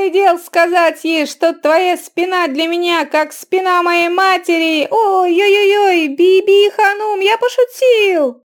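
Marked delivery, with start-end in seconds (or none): 4.9-8.4 high squealing, loud, slow; panicked backpedaling. A narrator's reading. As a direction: caught out, beg forgiveness fast. Barker caught by the wife.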